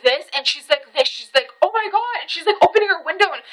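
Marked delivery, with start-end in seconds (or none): 1.6-2.2 retold panicked exclamation. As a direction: imitate a horrified onlooker, voice jumping up. Woman's panic as pole falls.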